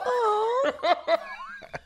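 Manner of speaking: very high delighted giggle. Real, not acted. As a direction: burst into giddy laughter. Laughing at daughter's coo.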